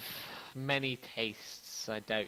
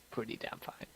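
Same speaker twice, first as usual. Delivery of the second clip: hushed; 8 dB quieter than usual. Retold or imitated. GM repeats line as player's thought.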